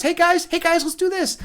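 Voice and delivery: imitating a hyper high-voiced artist greeting friends; hyper Alex Gray. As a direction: pitch way up like an overexcited host. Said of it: "Alex Gray's talking like this".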